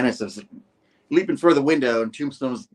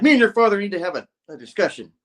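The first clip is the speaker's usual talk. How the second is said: fast threatening; 8 semitones higher, 6 dB louder, faster than usual. Acted. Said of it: "He's like".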